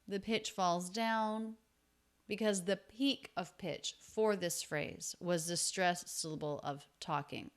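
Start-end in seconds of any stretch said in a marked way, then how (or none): none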